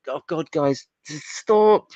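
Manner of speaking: fed up, begging the writers to quit; exasperated plea. Retold or imitated. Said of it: "and it's like".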